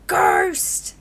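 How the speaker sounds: loud yelped "Ghost!"; possum yelp. Acted. Possum warning the player about the ghost, loud yelp. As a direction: blurt it out in fright.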